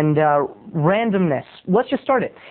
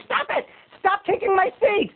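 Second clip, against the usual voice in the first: angry moviegoer; 13 semitones higher than usual. Retold or imitated. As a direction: imitate an irate woman in a tiny high voice. Acts angry woman turning round.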